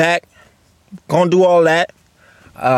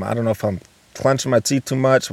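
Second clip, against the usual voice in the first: usual speaking voice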